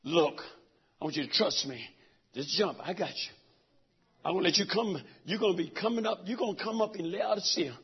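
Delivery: a lightly voiced gentle God; God speaking. God reassuring the soul sent to Laodicea, low and soft. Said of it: "He said".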